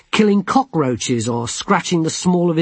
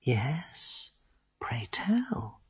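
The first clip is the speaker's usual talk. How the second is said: mocking hushed whisper; whispering dryad; 13 dB quieter, much slower than usual. A narrator's reading. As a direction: tease him in a whisper. Druelai whisper.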